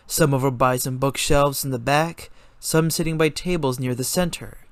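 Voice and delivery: ordinary delivery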